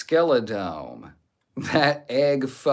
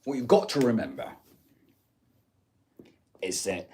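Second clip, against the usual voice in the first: attempted Andrew Tate accent; Andrew Tate impression; faster than usual. Streamer obliging a viewer's request, tentative Tate accent impression. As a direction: have a rough go at Tate's accent. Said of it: "can you do a Tate impersonation".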